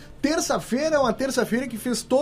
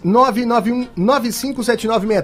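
slow, clear on-air promo read; radio ad read; 2 semitones higher, 3 dB louder than usual. Acted. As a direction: spell out the number slowly. Host reads the station's number.

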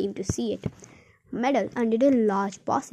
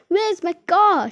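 loud, high, slow cry; cried out; 8 semitones higher, 8 dB louder than usual. A narrator's reading. Aunt crying out at the missing car, loud and high. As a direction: wail it out in alarm. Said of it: "she cried".

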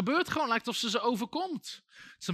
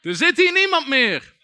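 loud, higher shout of the demon; preacher translating the demon's cry, loud and higher; demon's shout retold; 7 semitones higher, 11 dB louder than usual. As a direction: bellow it as the spirit insisting it's gone. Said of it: "die vrouw op de grond schreeuwde nog".

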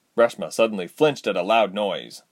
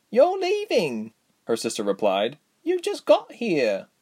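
put-on sad little girl voice; 7 semitones higher than usual.

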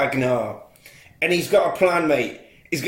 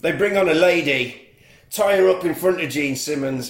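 ordinary delivery

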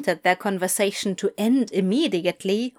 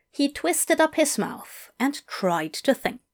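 ordinary delivery

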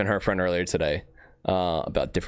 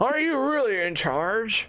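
slow, higher put-on villain voice; lightly voiced Bane line; Bane impression; 14 semitones higher, slower than usual. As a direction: drag out the line like a movie villain. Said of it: "You could have done a whole episode like Bane".

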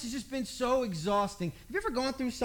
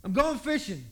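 louder, abrupt declaration; sudden announcement; 4 semitones higher, 5 dB louder than usual. Retold or imitated. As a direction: blurt it out of nowhere. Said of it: "out of nowhere, says".

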